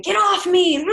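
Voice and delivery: retold angry yell; angry outburst. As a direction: snap it like a combative patient. Retold combative patient's outburst.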